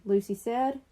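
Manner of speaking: usual speaking voice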